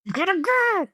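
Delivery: high hurried family voice; rushing dad. Retold or imitated. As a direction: urge everyone out. Joining the rushing parent.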